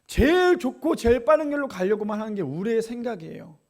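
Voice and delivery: usual speaking voice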